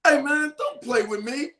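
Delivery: rising into a high shout; mock scolding yell at his son; dad losing it at his son, shouting; angry dad. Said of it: "I was like".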